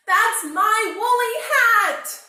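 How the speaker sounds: very high, very loud slow cry; anguished cry. A narrator's reading. Bear cub shouting at rabbit over his hat, very high and loud. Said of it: "cried Douglas".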